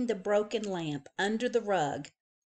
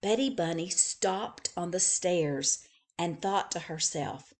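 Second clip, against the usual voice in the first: plain reading voice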